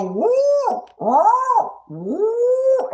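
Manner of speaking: high woo woo woo cat calls; imitating a cat barking. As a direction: yip high like a cat alone in a room. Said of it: "They go".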